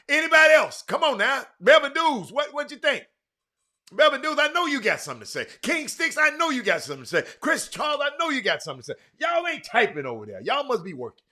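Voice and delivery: high, loud, rapid-fire prodding; loud calling out. Real, not acted. Host prodding silent viewers to comment, loud calling out. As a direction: call on each one loudly by name.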